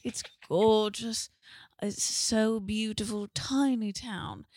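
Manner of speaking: drawn-out British tourist praise; lightly done British accent, slow and lilting. Retold or imitated. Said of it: "They're British".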